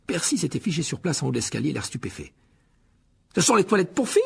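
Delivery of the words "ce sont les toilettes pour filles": high, loud outrage; scandalised exclamation. Acted. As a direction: cry out in shocked disapproval. Shocked prefect catches them. (exclamation scandalisée, forte et aiguë)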